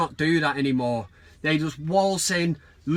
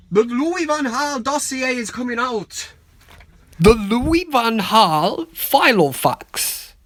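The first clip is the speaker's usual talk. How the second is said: hammed-up Dutch accent, louder and slower on Filofax; bad Dutch accent; 7 semitones higher, 6 dB louder, slower than usual. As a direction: announce it in a cheesy Dutch accent. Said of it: "That was a shit Dutch accent".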